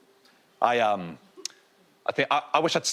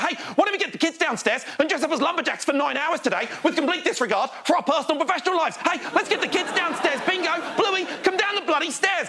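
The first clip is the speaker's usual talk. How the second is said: very high, manic cartoon-parent gusto; rally the kids with absurd excitement; over-enthusiastic parents; 12 semitones higher than usual. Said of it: "they're enthusiastic as the".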